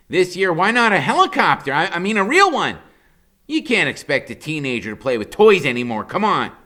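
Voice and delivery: put-on high, loud wisecracking boy; Freak's high smart-aleck voice. A narrator's reading. Boy joking about his own birthday, high and cocky. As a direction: crack the joke like a cocky kid. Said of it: "he says".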